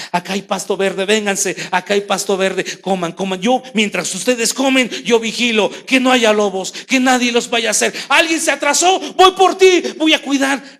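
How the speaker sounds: shepherd calling the flock, building to a high shout; a shepherd's call that swells to a shout. Acted. Shepherd calling his sheep.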